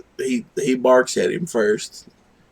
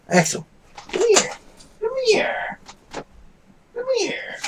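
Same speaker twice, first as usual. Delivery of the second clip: very high, thin pet call; soft falsetto come-hither; calling a dog; 4 dB quieter than usual. Real, not acted. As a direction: coax the dog in a tiny high voice.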